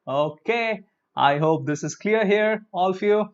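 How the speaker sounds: ordinary talk, nothing marked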